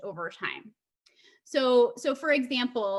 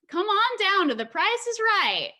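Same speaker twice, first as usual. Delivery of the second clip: high, loud game show announcer; a touch of Southern accent, loud and bright; game show host call; 10 semitones higher, 6 dB louder than usual. Retold or imitated. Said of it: "and in my accent".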